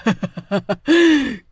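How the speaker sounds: evil gloating witch laugh. Acted. Witch laughs after her threat, evil laugh.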